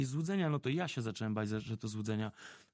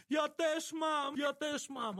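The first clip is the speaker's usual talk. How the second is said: put-on much higher, slow, quavering old woman's cry; trembling grandma crying out; 12 semitones higher, much slower than usual. Grandma on the bus to everyone, high trembling cry. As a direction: hold up the rosary and cry out like a shaky grandmother. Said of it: "trzęsąc się i krzyczy".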